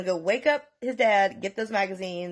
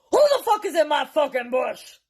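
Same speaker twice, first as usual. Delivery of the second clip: loud, higher-pitched challenge; angry dad yell; 7 semitones higher, 6 dB louder than usual. Retold or imitated. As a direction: imitate a dad yelling at an intruder. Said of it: "Just like that".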